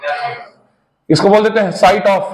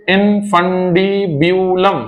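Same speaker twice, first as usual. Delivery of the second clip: slow spelled-out term; much slower than usual. Shown as a demonstration. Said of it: "क्या नाम है?".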